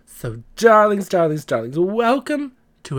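high, loud campy drag queen; big showy drag diva host; Donna Sugars drag voice. Acted. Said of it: "This is Donna Sugars".

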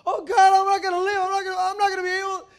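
high, loud disciple's cry; mock panic. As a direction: cry out as if drowning in a storm.

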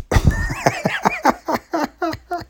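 high-pitched burst of laughter. Real, not acted. Storyteller cracking up at the punchline, high laughing. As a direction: laugh at the memory.

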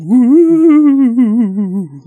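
high, loud Halloween whoop; a loud, high Halloween cheer; spooky woo. Acted. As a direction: throw out a big festive woo. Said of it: "Me going, woo?".

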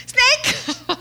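high, loud laughing shriek; my startled yell. Retold or imitated. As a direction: shriek it in mock alarm, laughing. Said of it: "Which is my reaction".

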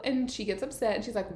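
usual speaking voice

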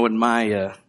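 ordinary talk, nothing marked

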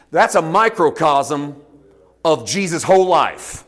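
usual speaking voice

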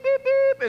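high, loud beep beep like a honking horn; imitated car horn. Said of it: "I did a couple beep".

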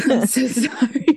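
slow, high apology through giggles; laughing apology. Real, not acted. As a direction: apologize while cracking up. Apologizes while laughing after cursing.

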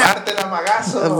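high, theatrical stage call; hammed-up shout to the magician; mock shout. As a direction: cry it out like a magician's heckler. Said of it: "Y le gritan".

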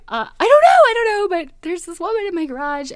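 high, loud burst, then easing off; acted fluster. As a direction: blurt it out in mock panic. Flustered answer to brother on phone.

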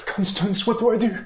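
high-pitched, lost and pleading; helpless high-voiced appeal; pleading Irina. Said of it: "she looks to you, Constance".

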